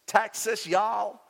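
hammed-up Texan American accent; mock Texas American accent; bad American accent. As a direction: put on a bad Texan drawl for fun. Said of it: "Sorry for the bad American accent".

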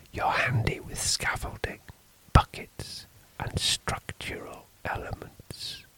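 nervous priest in a whisper. A narrator's reading. Priest whispering fearfully to the stranger in the dark. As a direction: keep it hushed and uneasy.

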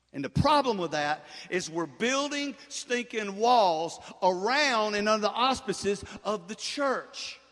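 loud, punched pulpit proclamation; full-force preaching. Real, not acted. Preacher tells church it has no walls, forceful proclamation. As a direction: hammer each phrase out to the congregation.

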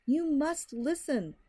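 slow, high, desperate plea; frantic cry. Acted. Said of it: "the man cried".

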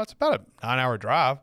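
usual speaking voice

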